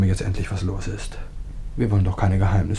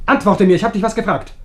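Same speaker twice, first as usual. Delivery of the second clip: loud, raised, menacing demand; angry demand; 9 semitones higher, 5 dB louder than usual. Acted. Husband angrily pressing his wife to answer. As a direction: bark the order at her.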